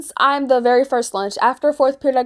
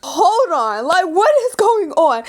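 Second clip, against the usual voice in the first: confused alarm; 8 semitones higher, slower than usual. Retold or imitated. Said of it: "And I'm like".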